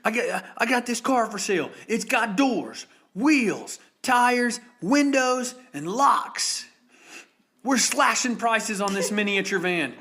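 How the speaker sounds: loud, high-pitched TV salesman shouting; hammed-up used-car commercial yell; car commercial pitchman. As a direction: belt out each selling point like a local ad. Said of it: "that guy with the commercial that goes".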